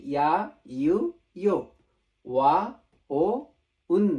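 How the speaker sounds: ইয়া, ইউ, ইয়ো, ওয়া, ও, উন as distinct single syllables; pronunciation demo. Shown as a demonstration. Teacher repeating the six new letters, demonstrating pronunciation. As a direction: recite ইয়া, ইউ, ইয়ো, ওয়া, ও, উন firmly, one by one. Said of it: "pronunciation আমি আরেকবার বলি".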